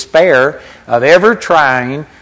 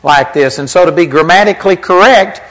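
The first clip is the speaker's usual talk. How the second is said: ordinary talk, nothing marked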